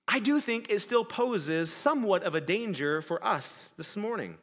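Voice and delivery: normal talking voice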